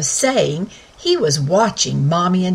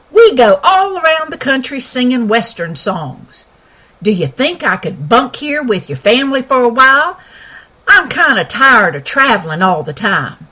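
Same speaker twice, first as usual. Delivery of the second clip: higher, louder cowboy pony voice; folksy Western pony, raised and bright; talking pony; 4 semitones higher, 4 dB louder than usual. Acted. Sky speaks.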